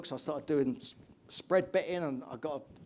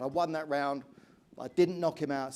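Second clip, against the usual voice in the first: ordinary talk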